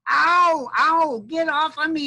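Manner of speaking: high, loud, drawn-out armadillo yelp; hammed-up squashed-animal yell; yelling in pain. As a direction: holler as if stepped on. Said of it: "it yelled at me".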